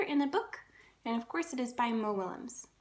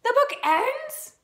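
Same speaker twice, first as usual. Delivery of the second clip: much higher, louder, slow alarmed question; 9 semitones higher, 6 dB louder, much slower than usual. Acted. Gerald shocked to learn the book ends, high and loud. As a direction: gasp it out in dismay.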